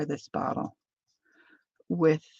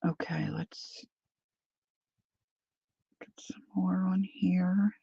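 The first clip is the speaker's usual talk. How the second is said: whispering; 2 semitones higher, 8 dB quieter than usual. Real, not acted. Muttering to herself while working.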